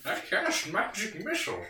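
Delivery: lightly voiced old wizard; a hint of an old wizard; generic old guy voice. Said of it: "It's my generic old guy voice".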